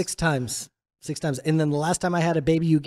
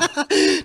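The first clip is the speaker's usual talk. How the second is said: laugh; 12 semitones higher than usual. Real, not acted. Host laughs at camel news.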